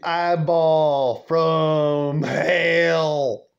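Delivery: drawn out word by word; slow, hammed-up build to the last word; dramatic drawl. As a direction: stretch it slowly for mock menace. Dramatic build-up of grandma's glare.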